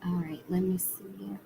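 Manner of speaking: soft unvoiced whisper. Real, not acted. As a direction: whisper it to yourself. Reader whispers to herself.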